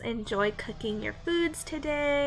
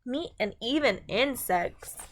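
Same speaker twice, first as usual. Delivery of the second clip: bright, clear storybook read-aloud; reading to children. A narrator's reading. Teacher reads a food book to her class, storybook voice.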